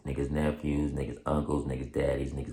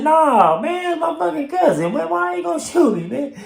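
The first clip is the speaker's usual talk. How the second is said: very high, loud exasperated denial; imitating the cousin; 7 dB louder than usual. The cousin denying it, shrill and loud. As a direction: shriek it like the cousin, fed up.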